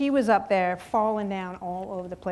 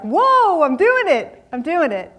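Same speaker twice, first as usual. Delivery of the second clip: louder, higher cry of delight; thrilled; 6 semitones higher, 10 dB louder, slower than usual. Retold or imitated. Woman finally skiing shouts with delight. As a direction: celebrate the first run down the slope. First success on skis.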